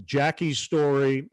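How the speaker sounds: usual speaking voice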